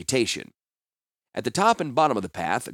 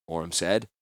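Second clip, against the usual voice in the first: ordinary delivery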